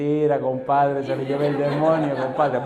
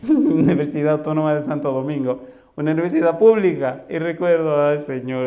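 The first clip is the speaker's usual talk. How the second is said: laughing; 2 semitones higher, 5 dB louder than usual. Real, not acted. Laughing while recalling university.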